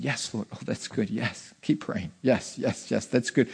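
soft, low murmured affirmations; murmured yes Lord. Retold or imitated. Pastor showing his murmured amens during prayer, soft. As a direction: murmur under your breath during prayer. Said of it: "I just keep saying".

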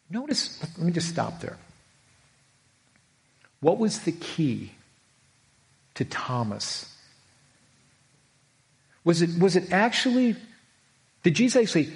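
normal talking voice

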